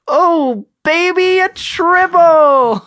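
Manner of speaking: very high, slow squealing voice; quoting a viral clip. Retold or imitated. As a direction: squeal it, stretched out and delighted. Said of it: "I think for me, it's the".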